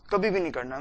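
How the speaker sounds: usual speaking voice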